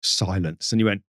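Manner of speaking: usual speaking voice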